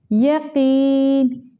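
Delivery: यकीन with the vowel after qaf drawn out slowly; long qaf vowel. Shown as a demonstration. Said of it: "कॉफ को हम लंबा करेंगे".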